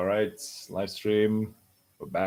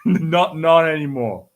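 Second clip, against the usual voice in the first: laughing protest; 2 semitones higher, 7 dB louder, slower than usual. Retold or imitated. Laughing about a friend's shipping.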